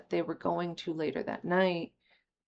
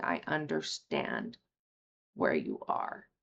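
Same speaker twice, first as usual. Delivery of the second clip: gentle; 4 dB quieter than usual. Retold or imitated. Quoting the gentle speaker.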